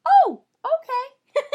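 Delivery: very high, loud, slow giggle; delighted mock surprise with a laugh; laughing squeal. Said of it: "And she goes".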